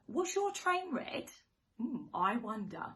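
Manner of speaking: ordinary delivery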